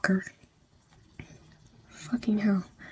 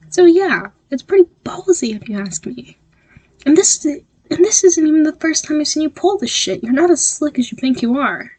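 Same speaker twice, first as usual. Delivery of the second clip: angry; 8 semitones higher, 3 dB louder than usual. Acted. Angry classmate confronting the listener.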